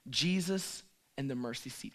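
ordinary talk

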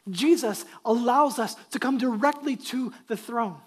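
fervent preaching. Real, not acted. Pastor telling the church they are sent to every nation, fervent preaching. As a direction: preach it with force, punching key words.

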